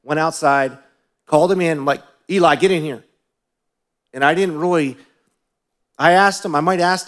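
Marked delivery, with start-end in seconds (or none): 2.3-3.0 put-on raised, higher voice summoning a child; stern father calling. Father calls his son in from outside, retold sternly. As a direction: re-enact a worked-up dad's order.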